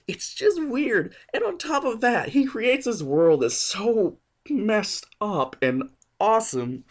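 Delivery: excited. Real, not acted. Gushing about weird world.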